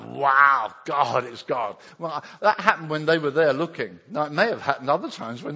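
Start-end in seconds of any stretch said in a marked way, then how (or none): 0.0-1.8 a loud amazed wow; awestruck. Retold or imitated. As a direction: gasp it out in wonder. Disciples awestruck at transfiguration.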